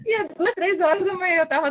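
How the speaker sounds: laughing through the words; amused disbelief, laughing as she tells it; giggling while telling. Real, not acted. Laughs recounting night sale.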